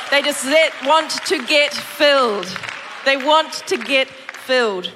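fervent pulpit voice; preaching at full force. Acted. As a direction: drive the message home to the congregation.

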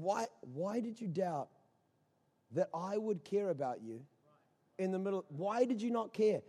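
very soft, low questioning; hushed, pausing appeal to doubters; tender reproach. Acted. Jesus's tender question to disciples.

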